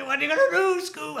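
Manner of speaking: high, loud cartoon squeal; Shaggy from Scooby-Doo. Proudly showing the partner the Shaggy, high loud cartoon voice. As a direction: pitch the catchphrase way up. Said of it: "my Shaggy thing's pretty good".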